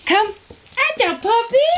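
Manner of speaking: excited puppy talk. Real, not acted. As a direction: squeal encouragement at the puppy. Praising puppy.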